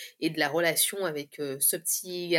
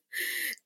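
high, soft chuckle; small amused laugh; soft, high-pitched giggle; 12 semitones higher, 6 dB quieter than usual. Real, not acted. Laughs at her own story.

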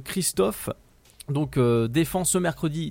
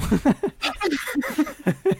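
burst of laughter; 13 semitones higher than usual. Real, not acted. Laughs at Pokémon joke.

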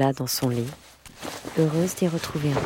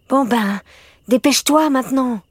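high, louder, exasperated urging; impatient hurrying; 9 semitones higher, 5 dB louder than usual. A narrator's reading. Camilla gives in and hurries Morgane, impatient and high. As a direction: hurry them along impatiently.

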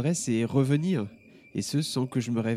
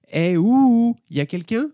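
interpellation forte et aiguë; héler quelqu'un dans la lune; appel; 12 semitones higher, 7 dB louder, slower than usual. Acted. Emma appelle Jonas distrait. (Emma hèle Jonas, fort et aigu)

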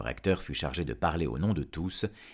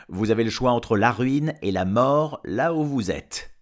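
loud, raised ultimatum; firm threat; 7 semitones higher, 9 dB louder than usual. Acted. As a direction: lay down the choice forcefully. Farmer threatens the dragon with death. (ultimatum menaçant, voix forte)

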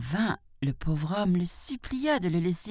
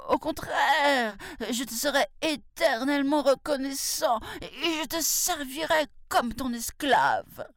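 high whimpering promise of slavery; tiger pleading; 10 semitones higher than usual. Acted. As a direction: beg through sobs, drawing the words out. Tiger begs to be freed.

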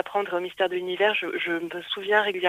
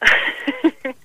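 short laugh; 8 semitones higher, 10 dB louder than usual. Real, not acted. Amused by the host's accent joke.

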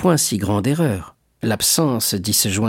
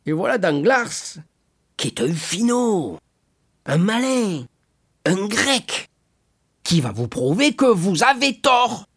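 high, tipsy, rambling voice; garrulous drunkard; 9 semitones higher than usual. A narrator's reading. Caderousse vouching for Danglars to Fernand, drunken chattiness.

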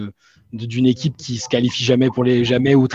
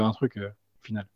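trailing off; 3 semitones lower, 10 dB quieter than usual. Real, not acted. Sentence fades out unfinished.

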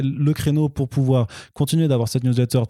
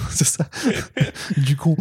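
normal talking voice

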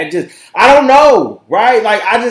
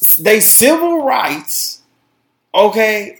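ordinary delivery

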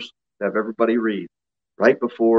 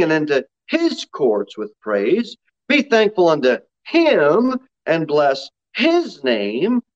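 "his" and "him" drawn out and punched; slow scripture reading with long, weighted "his" and "him"; stress on HIS and HIM; 4 semitones higher than usual. Real, not acted. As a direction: lean hard on every "his" and "him". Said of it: "I emphasize the word his and him".

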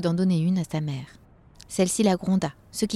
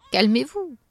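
raised-pitch call for calm; calming Santa; 6 semitones higher than usual. Acted. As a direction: settle the frightened elves. Santa calms the panicked elves.

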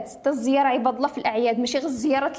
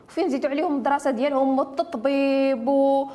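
normal talking voice

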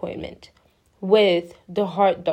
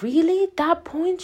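high-pitched disbelieving question; mock astonishment; 8 semitones higher than usual. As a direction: imitate a dentist taken aback. Voices dentist's astonished reaction.

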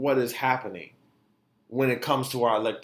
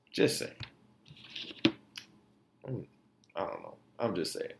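quiet aside; 7 semitones lower, 11 dB quieter than usual. Real, not acted. Trailing off after rant.

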